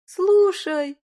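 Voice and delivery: sudden bright idea, high and loud; excited exclamation. Acted. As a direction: burst out with a new idea. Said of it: "У Людмилы округлились глаза".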